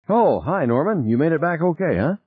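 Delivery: high, breezy casual greeting; carefree, pitched well up; unconcerned. A narrator's reading. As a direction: greet him lightly, as if nothing happened. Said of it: "She didn't sound concerned".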